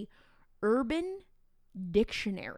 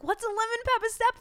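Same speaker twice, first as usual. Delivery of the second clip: much higher, louder confused question; teacher bewildered by her students, high; baffled high question; 12 semitones higher, 5 dB louder than usual. Retold or imitated. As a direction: squeak it out in disbelief. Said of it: "I'm like".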